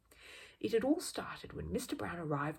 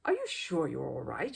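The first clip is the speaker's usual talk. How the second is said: lower, mildly worried question; a touch of anxiety; 6 semitones lower, 3 dB louder than usual. A narrator's reading. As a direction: gently check he is fine. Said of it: "she asked anxiously".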